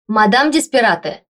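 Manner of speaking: very loud urgent call; deafening yell. A narrator's reading. Secretary rousing the boss with a deafening yell. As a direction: bellow the name to rouse her. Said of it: "раздался оглушительный вопль".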